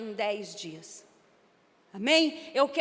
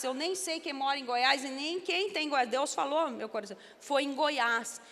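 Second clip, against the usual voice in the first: ordinary talk, nothing marked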